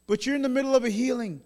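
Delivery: put-on high, quick child's protest; urgent objection. Child to busy father, urgently objecting. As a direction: blurt out the objection fast.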